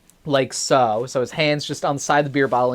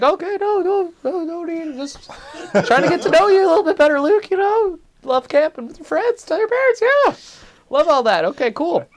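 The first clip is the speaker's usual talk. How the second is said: hasty, much higher voice, brushing it off; high-pitched backpedaling. Retold or imitated. Questioner backing off after being challenged, high-pitched backpedaling.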